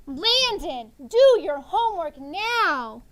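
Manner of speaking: loud, drawn-out urgent order; urgent yell, each word stretched; yelled command. Shown as a demonstration. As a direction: bellow it as a firm command. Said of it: "think I'm yelling at 'em".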